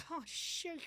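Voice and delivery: real laugh burst; laughing surprise. Real, not acted. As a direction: crack up in shock. Laughing at headline.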